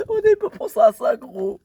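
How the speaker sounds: saying it through a laugh. Real, not acted. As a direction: laugh while you repeat it. Repeats the line while laughing.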